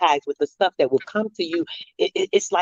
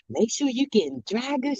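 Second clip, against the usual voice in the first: lightly voiced old lady, higher pitched; sweet old lady; 6 semitones higher, 4 dB quieter than usual. Old lady advising a shopper what to stock, lightly imitated. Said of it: "She was a sweet little old lady too".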